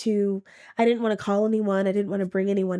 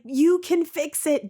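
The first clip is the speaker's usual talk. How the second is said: higher, slow angry demand to God; angry plea; 7 semitones higher, slower than usual. Retold or imitated. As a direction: plead in frustration, pitched up. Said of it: "I was so angry".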